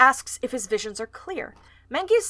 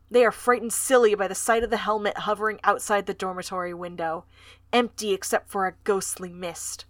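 ordinary delivery

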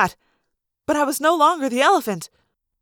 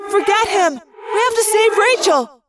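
urgent cry; 6 semitones higher than usual. A narrator's reading. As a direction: cry out to rally them. Urgent plea to save a friend.

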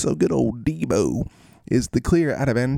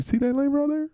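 much higher, sing-song lamb talk; high call to lambs. Real, not acted. Pointing out a lamb, in a high sing-song.

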